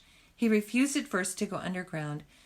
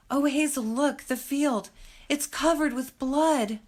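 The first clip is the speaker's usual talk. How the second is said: higher, louder and slow, horror rising; terrified; 7 semitones higher, 4 dB louder than usual. Acted. Terrified Fiver shows Hazel the field, slow and high with horror. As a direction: gasp it out as if the field really bleeds. Fiver panics seeing blood.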